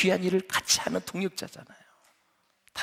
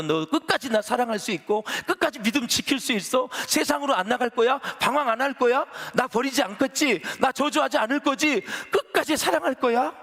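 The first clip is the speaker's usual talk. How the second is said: rising plea; 7 semitones higher, 4 dB louder than usual. Retold or imitated. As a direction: pitch climbs higher with each question, louder. Jesus voiced pleading, rising. (질문마다 점점 높아지는 간절한 호소)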